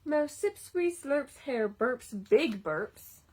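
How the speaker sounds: lively storybook read-aloud; reading to children. A narrator's reading. Reading a picture book to children, lively storytime voice. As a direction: read it like story time for little kids.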